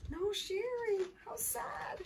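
high, soft, dismayed commentary; pitying aside. A narrator's reading. Narrator tells children how sad not sharing is, pitying aside.

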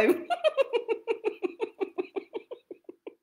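squeaky little twin giggle; giggling twin; high giggle. Acted. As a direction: let a high giggle bubble out. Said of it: "laughed little Miss Twin".

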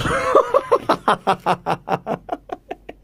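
rising high laugh at the losses. Real, not acted. (tawa tinggi)

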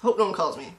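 ordinary talk, nothing marked

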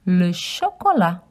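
Le chocolat as sho-ko-la, no t; French pronunciation. Shown as a demonstration. Showing children how chocolat sounds in French. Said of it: "It just sounds slightly different".

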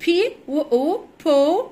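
letter names first, then the joined sound بو; spelled out. Shown as a demonstration. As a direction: slowly sound out بي and أو, then blend them into بو. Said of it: "تعالوا نقسمها، هي أربع حروف".

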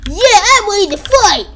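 high, loud, slow battle cry. Acted. Toy hero shouting he's ready. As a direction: psych yourself up.

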